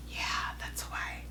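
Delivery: soft sheepish whisper; whispered yeah that's why; whispered aside. Real, not acted. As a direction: drop it to a breathy aside. Aside about looking at phone.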